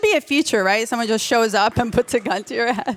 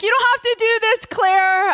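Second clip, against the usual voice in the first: mock exasperated plea; 13 semitones higher, 5 dB louder than usual. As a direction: cry out high and loud in protest. Mock pleading with her friend.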